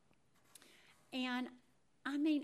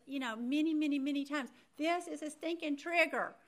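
higher and louder, exclaiming to herself; an "oh my gosh" moment, pitched up; alarmed realization; 5 semitones higher, 5 dB louder than usual. Retold or imitated. Recalling the moment the word trigger hit her, higher and exclaiming.

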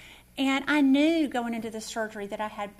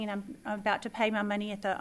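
ordinary talk

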